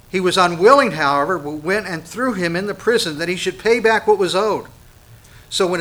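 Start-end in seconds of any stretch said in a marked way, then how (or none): 0.1-0.9 unwilling hit loud at a higher pitch; stress on unwilling. Real, not acted. Preacher drives home that he was unwilling, loud stress. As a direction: punch the word unwilling. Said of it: "I just want to emphasize".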